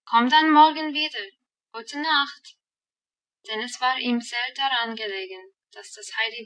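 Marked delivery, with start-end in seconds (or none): 0.1-2.6 put-on louder call after a departing girl. A narrator's reading. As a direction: call out goodbye across the pasture. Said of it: "rief dem Heidi nach". (laut nachrufend)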